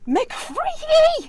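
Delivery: very high, long drawn-out shriek of his name; furious scream. Acted. Lady shrieking at her hired man after the crash, drawn-out.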